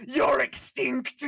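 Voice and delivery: high, loud and slow; astonished shout. Acted. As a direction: cry it out pointing in shock. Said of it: "points at him and he goes".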